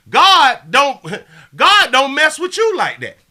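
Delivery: high, loud punchline; loud laughing retort. Real, not acted. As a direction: throw the line back with a laugh. Laughing retort about God.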